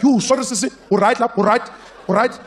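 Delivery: put-on high and loud, flustered South African accent. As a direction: fuss over the bomb in a heavy South African accent. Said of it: "he'd be like".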